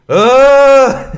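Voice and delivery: sudden high piercing shriek; a loud, high test scream. Acted. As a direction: shriek right into the mic to make it clip. Shriek testing clipping.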